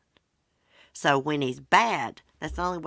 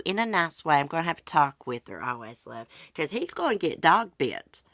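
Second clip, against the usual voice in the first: normal talking voice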